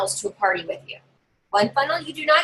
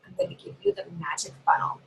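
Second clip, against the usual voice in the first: dead serious; 8 semitones higher, 7 dB quieter than usual. Shown as a demonstration. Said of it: "I'm real serious".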